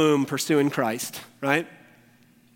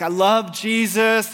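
mock weeping; 6 semitones higher, 7 dB louder, slower than usual. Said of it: "weeping and being like".

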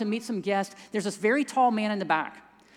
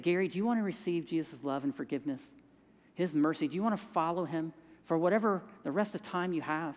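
soft, sincere invitation; 4 semitones lower, 5 dB quieter than usual. Retold or imitated. Inviting dying man to faith.

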